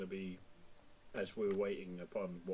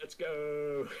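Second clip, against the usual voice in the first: excited cheer; 5 semitones higher than usual. Acted.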